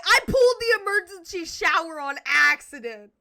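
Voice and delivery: loud mock confession; imitating loud blurting. [name] answering the mean teacher, loud mock blurt. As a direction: blurt it out at full volume, hammed up.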